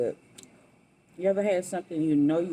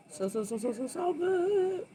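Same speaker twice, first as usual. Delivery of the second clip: delighted; 12 semitones higher than usual. Real, not acted. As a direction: stretch it out, rising with joy. Savoring food on camera.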